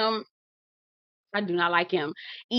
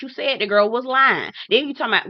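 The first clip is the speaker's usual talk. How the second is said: ordinary talk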